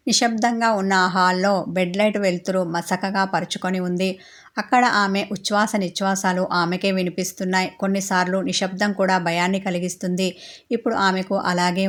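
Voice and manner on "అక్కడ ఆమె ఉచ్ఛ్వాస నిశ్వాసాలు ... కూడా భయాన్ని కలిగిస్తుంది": plain reading voice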